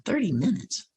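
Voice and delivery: hushed baffled retold thought; puzzled inner echo. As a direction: think it aloud, quietly incredulous. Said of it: "And I'm thinking".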